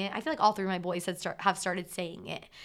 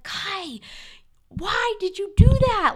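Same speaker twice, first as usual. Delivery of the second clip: sharp, much higher, much louder cry of frustration; frustrated; 12 semitones higher, 12 dB louder, slower than usual. Retold or imitated. Mom scolding her three-year-old, frustrated and loud. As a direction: catch him making a mess, let it fly. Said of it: "Like, so frustrated".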